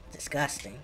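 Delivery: ordinary talk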